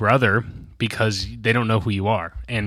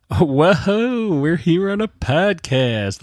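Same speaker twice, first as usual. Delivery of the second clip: high, drawn-out mock excitement; hyped podcast character; 8 semitones higher, much slower than usual. Host mocking his own first couple of episodes, hyped podcast character. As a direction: whoop and stretch it like a goofy new host. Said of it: "Let's do characters".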